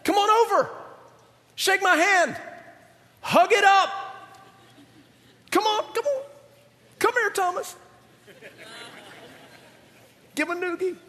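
high-pitched, playful beckoning; comic, eager invitation in short bursts; coaxing Jesus. Acted. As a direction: coax frightened friends closer in a high voice. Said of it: "Then he says this".

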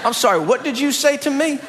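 high, sharp mock outrage with a laugh; mama daring her son to repeat it; indignant mom. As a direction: demand it again like a mother who can't believe her ears.

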